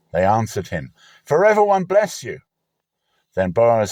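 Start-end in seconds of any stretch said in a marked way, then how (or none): 1.2-2.4 higher, louder called reply; reapers answering. Acted. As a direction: shout the blessing back.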